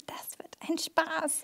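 mischievous whispered giggle; giggling whisper. Acted. Girl giggles softly, plotting the prank on Hugo.

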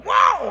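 very high, loud yell; hollered whoa. Retold or imitated. Showing how he hollered leaving the room, loud high holler. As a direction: holler it at full blast, high. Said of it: "I literally hollered".

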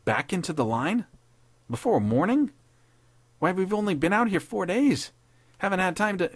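high-pitched, disbelieving questions; incredulous protest. Acted. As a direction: protest the news in rising disbelief. Lieutenant shocked at orders to return.

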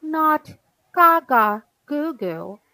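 mildly indignant big sister setting it straight; bossy correcting. A narrator's reading. Big sister telling baby it's wrong, bossy correcting. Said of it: "D W explained".

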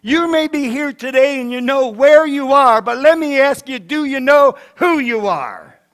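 loud, pressing preacher's challenge; full-force preaching. Real, not acted. Preacher challenging the congregation on who they are, full force. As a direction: push the question out to the whole room.

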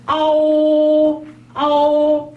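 아오 stretched slowly, high and loud; long drawn 아오. Shown as a demonstration. Teacher modelling a slow 아오. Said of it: "앞에 거하고 한번 비교해보세요".

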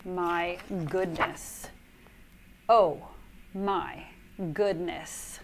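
slow, soft worn-out sigh; exhausted. Acted. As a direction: breathe it out, spent. Worn out after silly sounds.

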